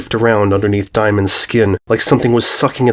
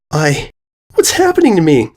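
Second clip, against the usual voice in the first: frightened; 6 semitones higher than usual. Acted. Crying filly.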